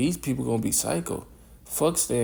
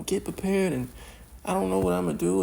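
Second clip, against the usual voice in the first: mocking panicked Edomites; 8 semitones higher than usual. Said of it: "these Edomites on TikTok".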